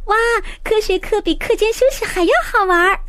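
high, excited classroom cheer; excited kids shouting. A narrator's reading. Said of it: "同学们又喊又叫".

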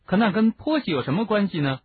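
high, baffled question; puzzled. A narrator's reading. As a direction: ask it in genuine bewilderment. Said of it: "哈利迷惑不解地问".

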